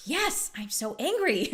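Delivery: high, slow mock caller agreeing; mock relieved caller; imitating angry caller. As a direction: play the caller relieved to be heard. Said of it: "the person was like".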